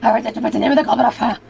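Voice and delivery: fast, loud, frantic British wizard; Dumbledore impression. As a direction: blurt it like a frantic headmaster. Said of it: "Harry Potter character".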